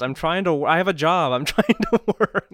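mock exasperation. Host retelling telling off a reply guy, mock exasperated. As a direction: play up being fed up with a laugh. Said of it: "I'm like".

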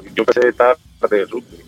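ordinary talk, nothing marked

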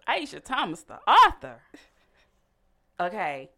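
high, loud, showy announcer build; excited hype. Real, not acted. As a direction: sell her like a star. Hyping guest as author.

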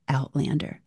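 ordinary talk, nothing marked

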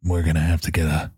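low, deadpan growl of the news; gruff low monotone husband; Witcher voice. Pretend. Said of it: "the voice I had to use".

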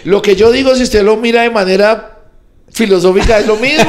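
high, loud, laughing tipsy rant; a whisky-loosened rant, yelling high and fast at times; tipsy shouting. Real, not acted. Tipsy comedian yells at his friend Pedro, loud and laughing.

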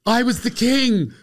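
imitating the teacher. Teacher corrects a student, mock offended and high. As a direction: huff it out, offended. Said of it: "And he goes".

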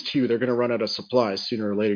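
normal talking voice

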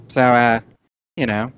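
matey Aussie koala salesman; Australian koala shopkeeper. Acted. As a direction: play a helpful Australian koala behind the counter.

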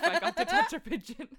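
loud, high, laughing glee; little kid gushing about touching a pigeon; mock childish glee. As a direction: burst out like a thrilled kid. Childish excitement retold.

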